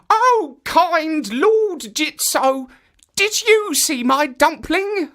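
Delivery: put-on high, slow pleading old woman's voice; old woman asking. A narrator's reading. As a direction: beg the statue politely in a high old voice. Old woman asks second statue.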